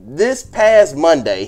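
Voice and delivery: ordinary delivery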